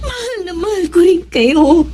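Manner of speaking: tearful. Acted. Olive to the family, tearful and moved. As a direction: voice catching on tears.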